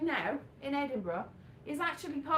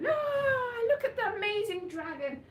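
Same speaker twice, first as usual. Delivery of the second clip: high, gushing mock awe at a crude drawing; hammed-up wonder; mock amazement; 10 semitones higher, 4 dB louder than usual. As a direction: oversell it like a proud kid. Showing off his MS Paint dragon.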